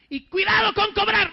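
high, loud preacher's warning; forceful retold admonition; retold stern warning. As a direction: warn them at full voice.

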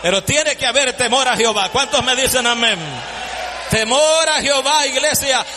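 full-force pulpit preaching; fiery preaching. Acted. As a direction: preach it out to the congregation. Preaching, calling for amens.

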